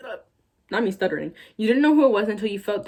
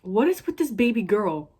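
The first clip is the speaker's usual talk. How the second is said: disgusted; 3 semitones higher, 3 dB louder than usual. Real, not acted. Disgusted at the fanfic line.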